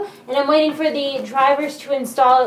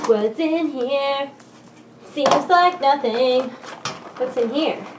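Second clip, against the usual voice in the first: slow curious murmur; 3 dB quieter, slower than usual. Real, not acted.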